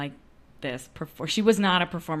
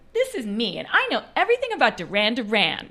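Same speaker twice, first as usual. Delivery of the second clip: put-on higher, louder bratty kid showing off; bratty little girl; 4 semitones higher, 5 dB louder than usual. Shown as a demonstration. Performer shows her earlier take on the girl, bratty. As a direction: play a know-it-all little girl. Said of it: "she was kind of bratty".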